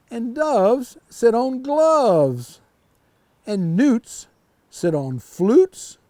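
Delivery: slow, drawn-out rhymes; reading to children. A narrator's reading. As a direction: linger on each rhyme for small listeners. Cat's rhyming list read aloud.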